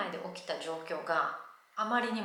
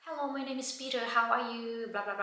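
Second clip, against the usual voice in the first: mock Irish accent; 4 semitones higher than usual. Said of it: "すっごいアイリッシュなまりのきつい早口で".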